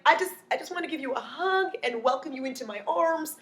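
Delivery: high, put-on sweet voice; mock a syrupy plea for a hug; imitated cloying girlfriend; gushy girlfriend.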